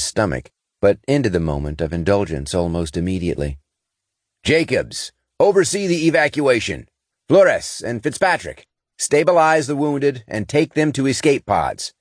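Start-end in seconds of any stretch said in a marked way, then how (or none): 4.4-11.9 barked orders. Acted. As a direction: bark the orders at the crew. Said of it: "he barked".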